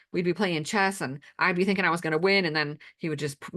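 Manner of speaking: ordinary talk, nothing marked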